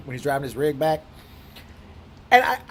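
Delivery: usual speaking voice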